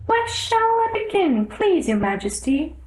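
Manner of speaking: anxious little rabbit pleading to the king. A narrator's reading. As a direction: squeak it out in a flustered hurry.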